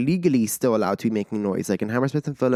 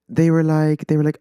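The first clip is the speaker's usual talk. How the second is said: usual speaking voice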